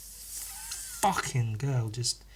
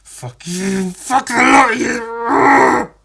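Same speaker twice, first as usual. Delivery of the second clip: building from higher to a slow, much louder roar; defiant curse; 9 semitones higher, 9 dB louder, much slower than usual. Retold or imitated. Bullied kid cursing out mocking classmates, slow and loud. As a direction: spit it back at the whole class.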